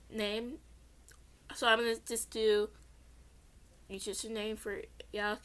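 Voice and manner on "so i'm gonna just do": plain reading voice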